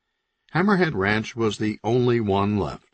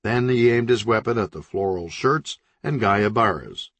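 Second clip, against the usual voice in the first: usual speaking voice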